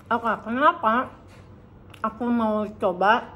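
usual speaking voice

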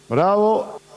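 ordinary talk, nothing marked

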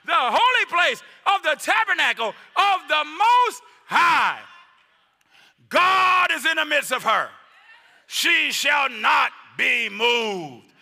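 high, loud, slow emphatic scripture shout; reading with emphasis. Acted. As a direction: proclaim the psalm with full force. Preaching scripture at full force.

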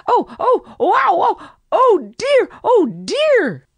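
high, yelping cries of pain; a young fox yelping in hurt; pained yelps. A narrator's reading. Reddy Fox hurt by the wire barb, yelping high. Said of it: "It was the voice of Reddy Fox".

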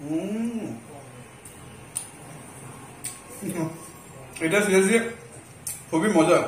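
speaking between bites; talking while chewing. Real, not acted. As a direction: mouth partly full. Seen 1: Bit kebab roll at -7; still chewing, lips closed, wiping mouth.

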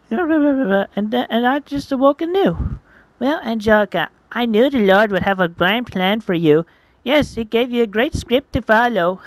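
put-on high, sing-song devout girl character; pious young Angelica. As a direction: proclaim faith in a high, lilting voice.

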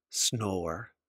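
quiet snore noise; soft snore. Acted. Snoring sound in list.

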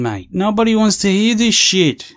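usual speaking voice

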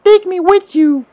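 slow, very high squeaky creature plea. Acted. Creature asking the player to bring it along, squeaky voice. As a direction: squeak it slowly in falsetto. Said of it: "You gotta give it a squeaky voice".

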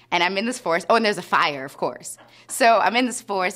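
ordinary talk

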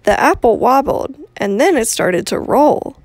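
put-on reading to children. Outdoor reading to children, animated storytelling. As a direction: tell it like a bedtime story.

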